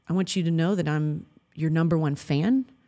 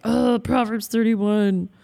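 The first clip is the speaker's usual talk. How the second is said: higher, whiny mock voice; mock despairing; 5 semitones higher than usual. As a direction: play a defeated wife sighing over an ideal. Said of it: "don't be like".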